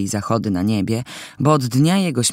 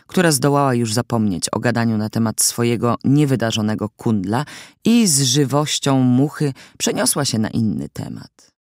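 ordinary delivery, nothing marked

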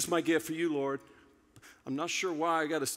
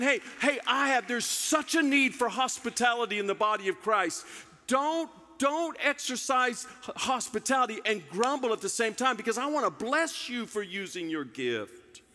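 raised, urgent pleading as God; voicing God; 7 semitones higher than usual. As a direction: plead high and strong, like God calling out. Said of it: "God's saying".

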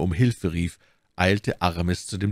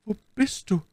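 very high, pleading female voice; dying woman; 14 semitones higher than usual. A narrator's reading. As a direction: plead as your strength fades. Dying woman pleads with her lover. (hohe, flehende Stimme einer Sterbenden)

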